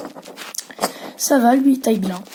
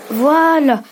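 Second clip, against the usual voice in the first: "Voilà" louder and higher, the last vowel stretched; loud drawn-out voilà; 4 semitones higher, 6 dB louder than usual. Real, not acted.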